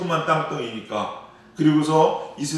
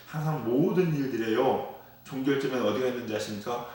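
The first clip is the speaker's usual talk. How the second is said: usual speaking voice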